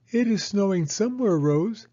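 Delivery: put-on high, quick child's voice; little girl Penny. Penny telling her doll Rose, fast high childlike voice. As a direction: chatter brightly like a small girl.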